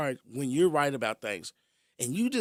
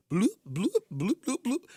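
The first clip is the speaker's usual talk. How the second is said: playful popping bloops, rising high; cartoon bloop sounds for the leak; bubble noises; 6 semitones higher than usual. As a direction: imitate bubbles bursting everywhere. Imitating gas leak bubbles.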